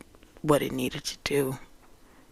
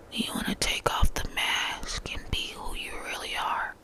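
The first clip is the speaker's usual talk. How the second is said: dreamy intimate whisper; 2 semitones higher than usual. Real, not acted. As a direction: murmur it like a meditation. Mystical whispered musings.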